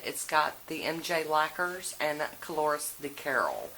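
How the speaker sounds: ordinary delivery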